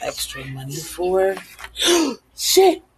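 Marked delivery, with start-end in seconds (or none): 1.4-2.2 sharp, loud gasp; startled gasp. Real, not acted. Spilled soda on herself mid-recording. 2.4-2.8 loud, high-pitched startled yelp; startled cursing. Real, not acted. Spilled soda on herself mid-recording.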